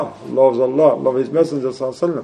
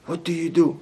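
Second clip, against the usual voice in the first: nosy question; 3 semitones higher, 6 dB quieter than usual. Retold or imitated. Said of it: "they even ask".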